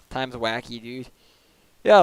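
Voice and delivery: ordinary talk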